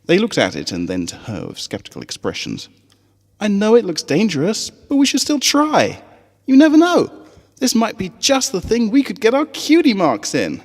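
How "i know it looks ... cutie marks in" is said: higher, eager schoolgirl; a keen young pony showing her book; high little filly voice. Acted. Sweetie Belle showing her friends the page, high and eager.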